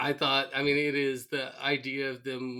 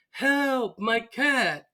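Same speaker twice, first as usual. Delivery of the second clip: high, drawn-out damsel wail; mock cry for help; 10 semitones higher, 4 dB louder, much slower than usual. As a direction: imitate a woman calling for rescue. Retold woman calling for rescue again.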